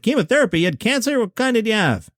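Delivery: high shocked disbelief, voice jumping up. Retold or imitated. Jackie Mason reacting to chemotherapy, shocked and high. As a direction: play Mason stunned by the news.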